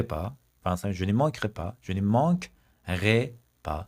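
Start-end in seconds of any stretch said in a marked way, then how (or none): 1.8-3.8 slow drill. Shown as a demonstration. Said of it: "teacher drills expression".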